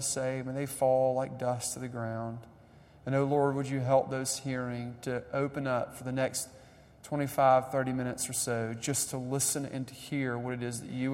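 soft, hushed opening prayer; preacher's soft opening prayer before the sermon. Real, not acted.

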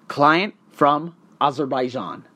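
ordinary talk, nothing marked

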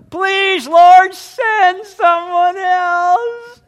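high, loud, very slow drawn-out whine; whiny Moses. Acted. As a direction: stretch the plea out in a whine. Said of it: "he starts to whine".

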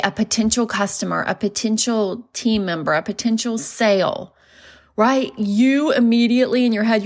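normal talking voice

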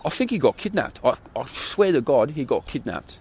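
mock English lad, starting high; English accent. English guys insisting their mate got kidnapped, English accent. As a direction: put on an earnest English accent. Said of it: "these English guys come home".

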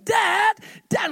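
high, loud imitation of yelling kids; boys yelling. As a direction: yell for Dad from across the house. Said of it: "two boys are yelling".

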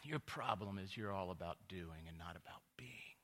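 hushed, unvoiced mock-wise friend; imitated whisper. Friend Chris telling pastor his problem, in a mock whisper. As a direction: breathe it like a secret insight. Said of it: "He goes".

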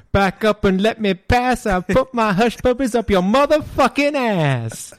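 high, brash pimp-style rhyming boast; Dolomite. Acted. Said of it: "That's my Dolomite".

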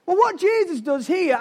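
usual speaking voice

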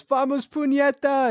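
very high, drawn-out cheer; stretched high-pitched holler, not full volume; mock scream. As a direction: imitate your own hype cry, pitch way up. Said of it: "I just kept screaming that".

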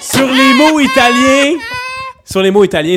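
high, loud, strained by laughter; fighting laughter. Real, not acted. Trying to finish the lesson despite gin jokes, real laughter-strained shout. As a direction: force the words out over the giggles.

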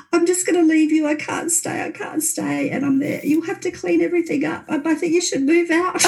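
high, breathless, panicked voice, partly whispered; frantic and scared. Retold or imitated. Terrified guest telling May she's leaving, frantic. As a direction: gasp it out in terror, pitched high.

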